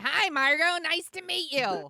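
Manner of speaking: high, loud put-on smooth voice; John Corbett impression. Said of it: "was he like".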